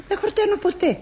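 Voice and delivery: usual speaking voice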